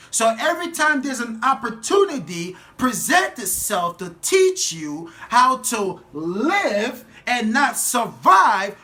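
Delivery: loud, high, drawn-out sermon shout; slow, impassioned high-pitched holler; full-force preaching. Real, not acted. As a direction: preach it at full volume, stretching the key words.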